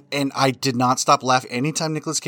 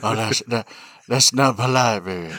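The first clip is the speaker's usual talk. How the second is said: through gritted teeth; 4 semitones lower than usual. Retold or imitated. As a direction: tease like a smirking gangster. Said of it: "he talks like this".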